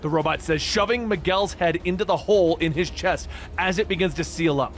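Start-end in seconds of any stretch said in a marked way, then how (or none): none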